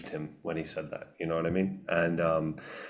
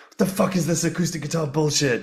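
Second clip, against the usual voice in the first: imitating an outraged online rant, pitched high; higher-pitched mock indignation at bullshit music; mock angry commenter; 9 semitones higher, 4 dB louder than usual. Musician mimicking YouTube commenters angry at his acoustic version, mock outrage. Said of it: "getting really angry".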